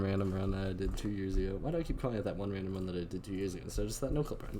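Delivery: rapid-fire, quiet auctioneer patter; auctioneer fast talk. Real, not acted. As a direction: rattle it off like an auctioneer. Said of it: "I just talked like an auctioneer".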